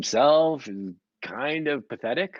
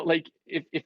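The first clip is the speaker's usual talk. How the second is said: ordinary delivery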